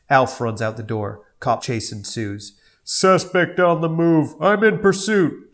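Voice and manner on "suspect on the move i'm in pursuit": cop voice. Acted. Cop voiced in read script.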